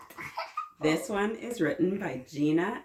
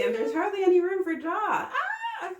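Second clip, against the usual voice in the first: playful alarm; 13 semitones higher, 3 dB louder than usual. Pretend.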